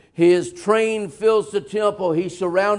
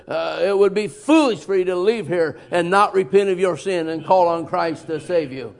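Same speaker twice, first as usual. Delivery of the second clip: ordinary delivery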